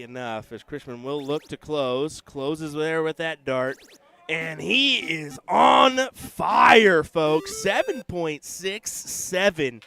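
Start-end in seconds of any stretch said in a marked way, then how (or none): 4.3-9.8 loud, high, stretched-out sportscaster call; excited shout. Real, not acted. Commentator hailing a player on fire, excited shout. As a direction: belt it out slowly for the crowd. Commentator calls a hot dart streak.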